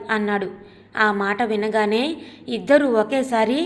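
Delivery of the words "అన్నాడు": plain reading voice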